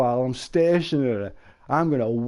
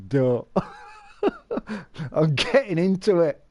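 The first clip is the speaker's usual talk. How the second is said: ordinary delivery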